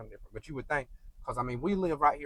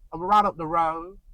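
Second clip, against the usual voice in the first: put-on thick Southern accent; 5 semitones higher, 8 dB louder than usual. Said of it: "They'll be like".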